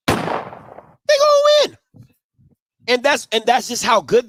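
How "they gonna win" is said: "They gonna win" squealed up high and slow; high stretched squeal. Real, not acted. Repeats the line, then much higher.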